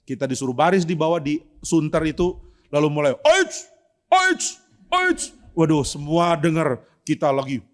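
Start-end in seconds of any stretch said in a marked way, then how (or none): 3.2-5.3 high, sharp karate yells; karate kiai. Retold or imitated. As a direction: shout like a karate master drilling. Karate shouts demo.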